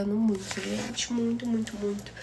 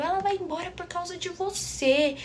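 mother blaming; 7 semitones higher than usual. Retold or imitated. Mother blaming father, retold.

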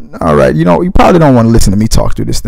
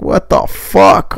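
mock baby; 5 semitones higher, much slower than usual. First take of the baby line.

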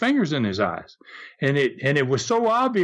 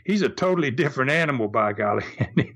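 plain reading voice

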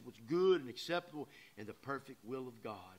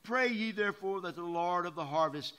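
preaching scripture; 8 dB louder than usual. Acted. Preacher reading the harvest verse to his church, loud proclaiming. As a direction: proclaim the call for laborers.

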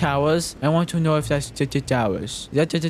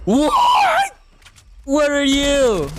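much higher, loud, slow bewildered yell; shocked shout; 12 semitones higher, 6 dB louder, much slower than usual. Real, not acted. Gamer ambushed by an unseen enemy, shocked shouting.

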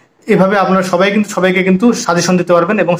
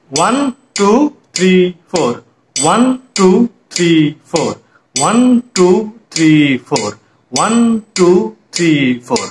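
counting to metronome; slower than usual. Shown as a demonstration. Teacher demonstrates practice with a metronome, rhythmic counting. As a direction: count in strict time with long, even beats. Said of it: "মেট্রোনম ব্যবহার করেন".

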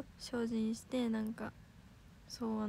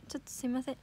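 normal talking voice

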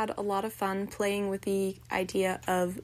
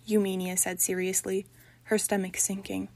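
ordinary delivery, nothing marked

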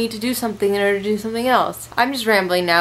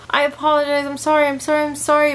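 higher-pitched pleading sorry; high apology; 6 semitones higher, faster than usual. Real, not acted. Apologizing to viewers.

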